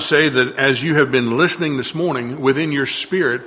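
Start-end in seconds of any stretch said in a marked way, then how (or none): none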